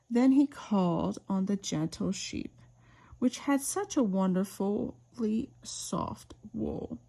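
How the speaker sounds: usual speaking voice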